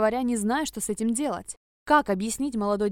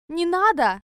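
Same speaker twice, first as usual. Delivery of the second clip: high, loud shriek of fear; 7 semitones higher, 7 dB louder, slower than usual. Acted. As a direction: squeal in panic. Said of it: "завизжала".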